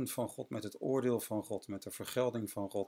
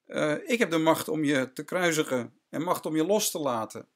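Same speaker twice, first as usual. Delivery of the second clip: higher, forceful Pilate claiming his power; Pilate asserting power; 6 semitones higher, 6 dB louder than usual. Retold or imitated. Pilate telling Jesus he holds power, higher and forceful. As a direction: state it high and hard, a governor flexing. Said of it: "Pilatus die zegt".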